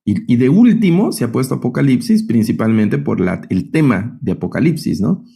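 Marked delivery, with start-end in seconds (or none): none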